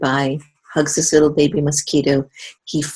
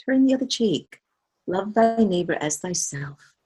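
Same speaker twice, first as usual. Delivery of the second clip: quoting Jesus; 7 semitones higher, 4 dB quieter than usual. Retold or imitated. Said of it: "I remember Jesus saying".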